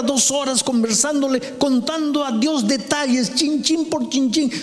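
high, driving, repetitive exhortation; high-pitched insistent sermon delivery; fervent preaching. Acted. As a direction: pile up the phrases with urgency. Preaches urgently, voicing a questioner.

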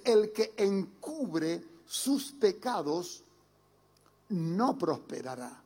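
each word of El que encubre sus pecados laid out very slowly; slow scripture reading. Shown as a demonstration. Preacher reading Proverbs to the congregation, slow and weighty. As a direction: read the verse slowly, weighing every word. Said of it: "El libro de Proverbios veintiocho trece dice".

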